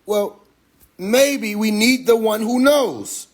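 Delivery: drawn out slowly, wondering aloud; slow musing quote. Retold or imitated. Mocking people who wonder about the future, slow musing. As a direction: let the thought dawn on you slowly. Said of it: "enough to be like".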